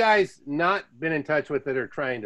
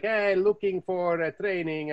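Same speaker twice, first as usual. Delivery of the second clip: put-on slow, drawn-out Italian-accented English, pitched up; imitated Italian accent; 6 semitones higher, slower than usual. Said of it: "he has an accent".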